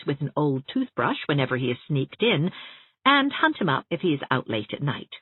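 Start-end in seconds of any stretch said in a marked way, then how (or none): none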